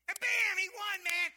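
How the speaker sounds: loud, high burst of triumph; proud dad exploding with excitement; excited. Real, not acted. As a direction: cheer the big win at full voice. Proud dad retells son's win.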